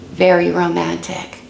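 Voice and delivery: usual speaking voice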